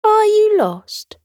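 tiny sweet dinosaur asking; little dinosaur. Acted. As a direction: squeak it out with concern. Dinosaur voice asks.

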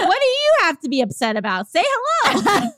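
high-pitched indignant demand; hammed-up scolding of a stranger; mock exasperation. Acted demand to unfriendly men.

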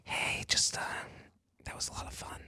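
breathy whispered come-on; mock whisper. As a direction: whisper it like a sly bedmate. Acting guy whispering in bed.

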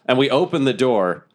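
normal talking voice

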